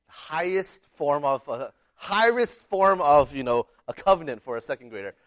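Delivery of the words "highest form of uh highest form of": drawn out slowly, getting louder; slow solemn build. Real, not acted. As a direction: stretch it out like a grand announcement. Building up the pinky swear.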